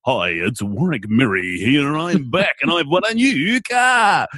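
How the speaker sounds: slow, booming radio-host voice, the car line shouted high; hammed-up prize announcer; grinning game-show boast; silly announcer voice. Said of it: "played with silly accents".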